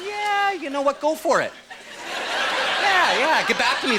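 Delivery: high, breezy drawn-out "yeah"; careless doctor. Retold or imitated. Doctor sending off traveller, high and breezy. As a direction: wave the patient off lightly. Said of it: "I don't need my doctor going".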